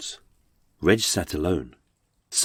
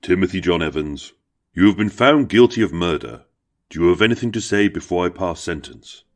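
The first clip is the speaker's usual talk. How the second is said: solemn, formal judge's voice; grave courtroom pronouncement; judge passing sentence; 3 semitones lower than usual. Acted. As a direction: pronounce it to the condemned man from the bench.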